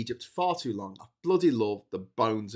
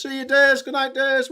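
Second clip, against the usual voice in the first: very high, loud, cartoon goodnight; high put-on crowd voice; 11 dB louder than usual. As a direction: answer yourself in a silly voice. Voices audience farewells.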